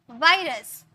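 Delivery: वायरस with a buzzing V; V sound. Shown as a demonstration. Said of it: "ऊपर वाले teeth से नीचे वाले लिप".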